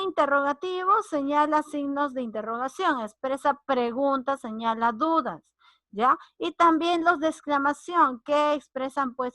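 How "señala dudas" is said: ordinary talk, nothing marked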